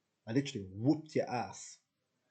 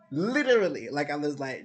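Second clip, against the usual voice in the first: worked-up burst; 4 semitones higher, 9 dB louder than usual. Real, not acted. Riled up recounting officer clash.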